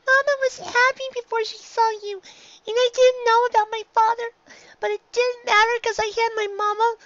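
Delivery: high filly voice pleading through tears; crying. A narrator's reading. Filly blaming the shopkeeper, crying as she pleads. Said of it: "drowned out by Dinky's tears".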